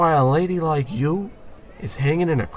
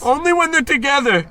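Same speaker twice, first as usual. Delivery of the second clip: much higher, loud defensive insistence; defensive squeak; 12 semitones higher, 4 dB louder than usual. Acted. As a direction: squeak out the lame excuse. Defends silly allergy excuse.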